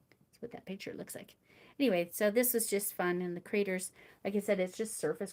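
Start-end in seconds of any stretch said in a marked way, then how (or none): 0.1-1.3 breathy half-whisper to herself; whispered aside. Real, not acted. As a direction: drop to a private whisper. Muttered aside about a picture.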